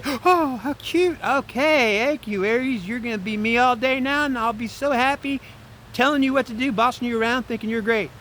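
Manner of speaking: very high, cutesy delighted voice; gushing sweetheart. Acted. She thanks Aries for picking her up, gushing. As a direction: coo it in a put-on girlish pitch.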